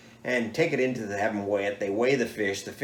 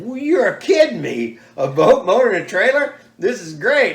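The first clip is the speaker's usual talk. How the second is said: much higher and louder, bursting with delight; thrilled; 10 semitones higher, 11 dB louder than usual. Retold or imitated. Winner hears of his boat prize, loud high thrilled. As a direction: gush like you just won the jackpot.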